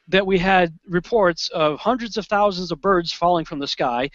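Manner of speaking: ordinary delivery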